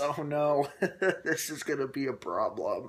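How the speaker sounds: amused, lightly hammed-up dread; laughing mock dismay. Reviewer reacting to the influence twist, laughing mock dismay. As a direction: chuckle through the "oh no".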